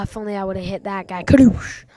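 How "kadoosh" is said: loud, high launch shout; catchphrase yell. Real, not acted. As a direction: yell the catchphrase as the bird flies. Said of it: "It's my thing".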